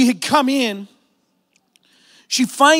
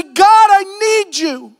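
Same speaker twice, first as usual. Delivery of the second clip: slow, raised cry of God I need you; drawn-out plea; 7 semitones higher, 5 dB louder, slower than usual. Acted. Pastor modelling a prayer for the congregation, slow and loud. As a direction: stretch each word like a prayer. Climactic plea at end of sermon.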